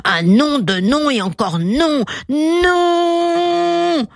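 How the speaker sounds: refus qui monte jusqu'à un non aigu et tenu; rugissement de refus. A narrator's reading. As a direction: monte vers un long NON rugi. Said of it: "rugit le lion". (lion qui rugit son refus)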